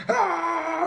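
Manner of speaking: anxious cry. Acted. Acting out anxious Chiefs fans, high mock wail. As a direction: wail like a panicking fan.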